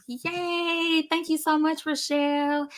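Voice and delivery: high, gleeful cheer; delighted thanks. Real, not acted. Host thanking Rochelle on the live, delighted. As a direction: squeal the thanks at a high pitch.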